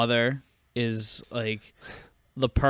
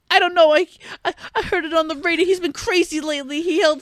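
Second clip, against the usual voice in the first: loud, very high falsetto woman; mock rambling wife; 5 dB louder, faster than usual. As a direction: ramble on in a shrieky put-on wife voice. Acting out the wife's rambling account.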